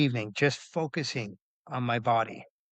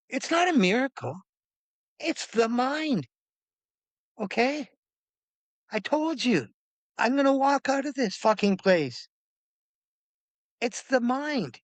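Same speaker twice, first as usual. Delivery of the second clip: high, deliberate defiant declaration; worked-up conviction, phrase by phrase; emphatic insistence; 10 semitones higher than usual. Retold or imitated. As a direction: declare it firmly, pitched up, with pauses. Acted defiant reply to amazed staff.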